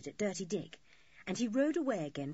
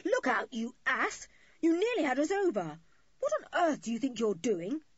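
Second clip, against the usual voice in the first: loud, high indignant yell; angry yell; 6 semitones higher, 5 dB louder than usual. A narrator's reading. Julian berates the rower who rammed the raft, yelling. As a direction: yell it out in outrage. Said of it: "He yelled at Dirty Dick".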